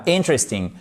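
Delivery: "Interesting" stressed on IN, falling after; short "Interesting" accented at the start; first-syllable stress. Shown as a demonstration. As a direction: hit IN and swallow the rest of "Interesting". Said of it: "l'accent tonique qui se trouve ici sur la première syllabe".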